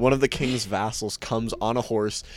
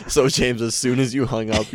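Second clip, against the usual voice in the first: usual speaking voice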